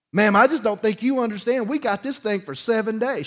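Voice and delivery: she's giving this woman fits, push back hard; arguing firmly. Retold or imitated. April arguing with realtor.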